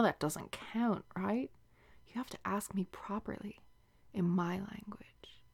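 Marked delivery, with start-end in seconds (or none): 2.1-5.4 soft, unvoiced seductive whisper; intimate whisper. Whispering to her lover as he touches her, seductive. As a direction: breathe it into his ear.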